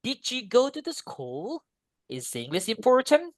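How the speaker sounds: closed questions climbing on the final word; rising question intonation. Shown as a demonstration. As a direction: lift "school" and "important" at the end. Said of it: "So, yang pertama". (nada naik pada "school" dan "important")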